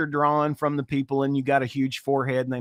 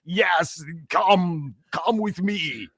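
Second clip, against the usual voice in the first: exaggerated Austrian action-hero voice; hammed-up movie-star accent; Arnold Schwarzenegger caricature; 3 semitones higher, 3 dB louder, much slower than usual. Shown as a demonstration. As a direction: call out like a cartoon Austrian strongman. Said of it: "Arnold Schwarzenegger character voice".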